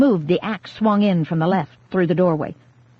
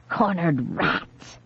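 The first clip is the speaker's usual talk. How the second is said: slow, soft, grinning sneer; slow gloating murmur; grinning menace; 2 semitones lower, 5 dB quieter, much slower than usual. A narrator's reading. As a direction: savour every word like a predator. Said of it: "she said, grinning".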